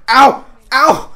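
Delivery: sharp, high, loud "ow"; two loud cries of pain; pained yelp. Real, not acted. Player being stabbed in the game, pained yelps.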